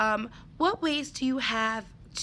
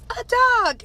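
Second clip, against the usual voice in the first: put-on much higher, loud kid voice; eager child's answer; 13 semitones higher, 10 dB louder than usual. Teacher imitating a first grader shouting his guess, high and loud.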